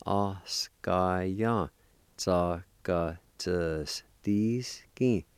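"oskaya tsakatsuski" in long, separated syllables; slow syllable-by-syllable. Shown as a demonstration. Teacher putting the parts together, slow syllable-by-syllable. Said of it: "practice and go slow".

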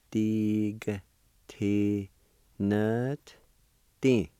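slow, each sound set apart; syllable by syllable. Shown as a demonstration. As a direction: sound out "Dig, T, nut, D" with pauses between. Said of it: "pronounce that T sound with the N V like this".

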